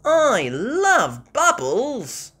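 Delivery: high, loud, slow gleeful cry; excited cry. Acted. Peppa popping bubbles, high gleeful cry. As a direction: gush with joy. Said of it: "cries Peppa".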